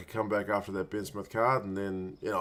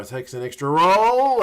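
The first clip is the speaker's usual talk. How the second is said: louder, higher, slowed protest; outraged call; 6 semitones higher, 9 dB louder, slower than usual. Real, not acted. Fan protests a player's extra roll, loud. Fan reacts to live play.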